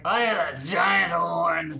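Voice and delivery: slow, loud boastful hero shout; cringe hero voice. Acted. Reading the fanfic's hero aloud, boastful shout. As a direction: brag it like an edgy teen hero. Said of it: "I'm not gonna keep doing that voice".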